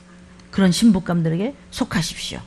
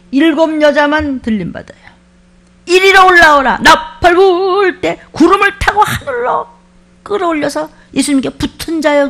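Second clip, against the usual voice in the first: rapture proclamation; 6 semitones higher, 9 dB louder than usual. Retold or imitated. Preacher proclaiming the rapture call to the congregation, booming. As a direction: call the chosen up to heaven.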